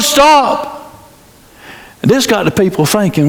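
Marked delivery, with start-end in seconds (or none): 0.0-0.9 high hollered STOP. Acted. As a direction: call out to halt the lash. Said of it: "He hollered".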